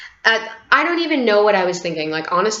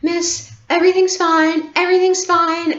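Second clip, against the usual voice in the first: much higher soothing mother voice; soothing mom; 11 semitones higher, 3 dB louder than usual. Retold or imitated. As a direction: reassure her gently as mom. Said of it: "my mom is like".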